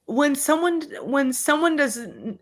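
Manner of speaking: choked restart. Real, not acted. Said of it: "I'm getting so emotional".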